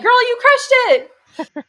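high, slow, laughing cheer of praise; excited cheer. Retold or imitated. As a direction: gush over her like a proud fan. Voicing Mrs. Donatelli cheering.

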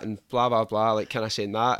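ordinary talk, nothing marked

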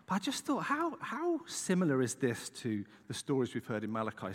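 usual speaking voice

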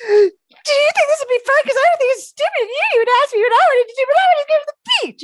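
put-on high-pitched tearful rant at her boyfriend; upset whining. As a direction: squeal it out like a sulking girlfriend. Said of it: "I started to get upset 'cause I'm like".

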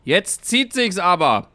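put-on older lady's loud complaint. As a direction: blurt it out like a bored old lady. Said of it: "sagte die ... ältere Dame".

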